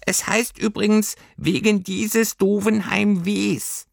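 high, slow little creature voice; a know-it-all little fur creature, correcting; prim little creature correcting grammar; Heimweh creature. Acted.

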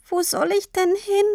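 put-on small, high child's peep; squeaked. A narrator's reading. Tini asks Papa where to go, squeaked. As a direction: squeak it helplessly. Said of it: "Sie piepste".

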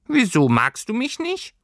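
very high, hurt little voice; disappointed squeaky creature. A narrator's reading. Creature asks girl if she dislikes it, disappointed. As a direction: ask it like a small creature let down. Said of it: "Seine Stimme klang enttäuscht".

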